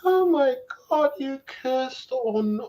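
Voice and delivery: put-on high, slow prissy British accent; hammed-up posh British gasp; mock British accent. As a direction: play a shocked British prude, drawn out. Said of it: "Sorry for the British accent".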